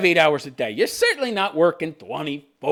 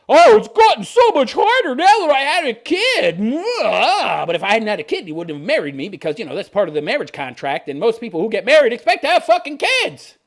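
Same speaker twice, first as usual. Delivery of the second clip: mock entitled wife; 6 semitones higher, 6 dB louder than usual. Voicing the wife mockingly.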